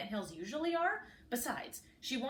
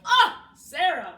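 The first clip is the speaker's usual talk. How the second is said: much louder, drawn-out wail; 6 semitones higher, 15 dB louder, slower than usual. A narrator's reading. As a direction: wail her name in despair. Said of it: "wailed the queen".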